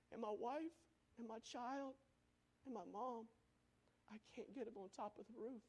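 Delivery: hushed, broken pleading; trapped man telling the anchor he can't save his family; desperate whisper. Retold or imitated. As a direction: voice the trapped man near tears. Trapped man despairing on phone.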